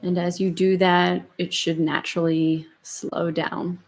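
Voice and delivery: slow, soft meditation voice; calm and unhurried, gently guiding; guided meditation. Acted. Leads the closing meditation.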